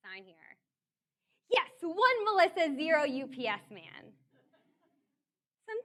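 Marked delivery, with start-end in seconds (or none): 0.1-0.6 low, quiet, resigned; defeated. Retold or imitated. The delivery man giving up and handing over the package, defeated. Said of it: "there's this defeat in his voice". 1.5-4.1 triumphant gloat. Real, not acted. Triumphant scorekeeping punchline.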